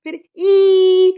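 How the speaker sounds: ई held long and slow; long drawn ई. Shown as a demonstration. Mother teaching spelling, stretching the vowel. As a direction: draw the ई out slowly. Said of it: "ऐसे लंबा खींच रहे".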